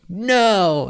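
high, loud refusal; defiant teen. Acted. Son refusing his dad, loud and high. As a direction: refuse him loudly. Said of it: "you're like".

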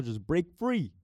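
building from loud call to high yell; escalating high-pitched hollering; breaking free. Pretend. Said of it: "trying to get out of my shell".

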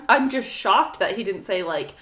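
normal talking voice

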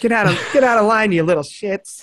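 high, fast rude elf; cranky elf voice. Retold or imitated. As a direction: chase the kids off like a grumpy elf. Elf voice continued.

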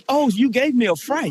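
high-pitched, over-proper mock fright; mock code-switching. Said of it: "he trying to tell us how he code switched".

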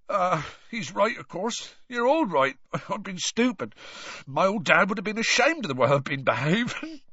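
high-pitched, choked rustic gamekeeper; Hagrid, ashamed. A narrator's reading. Gamekeeper admitting he was stupid, tearful and ashamed. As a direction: blurt self-reproach through tears.

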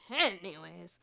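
sudden loud punch on the first syllable; too loud. Real, not acted. Vlogger checking that viewers are listening, really loud. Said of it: "I didn't mean to be that loud".

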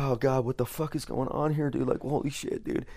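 half-whispered alarm, like it's all going wrong; panicked inner thoughts. Retold or imitated. To himself on the surgery table, a mock panicked mutter. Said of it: "'Cause I'm like".

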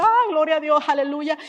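loud, high gleeful hallelujah; joyful praise. Retold or imitated. Wife recalling her delight at the perfect deal, loud joyful praise. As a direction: cheer it like good news.

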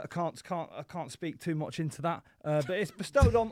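ordinary talk, nothing marked

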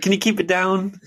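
high, snide mockery; mock snide hillbilly. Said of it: "He's like".

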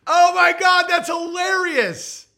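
loud, high, slowed shout of delight; delighted outburst. Real, not acted. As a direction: burst out loud at the joke. Cracking up at super chat.

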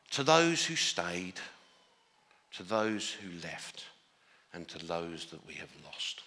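slow, hushed, reverent reading of a dedication; solemn reading. Retold or imitated. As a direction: let each phrase land slowly and softly. Reading the film's dedication aloud.

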